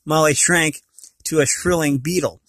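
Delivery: usual speaking voice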